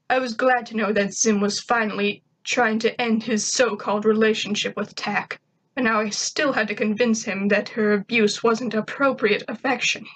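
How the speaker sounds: usual speaking voice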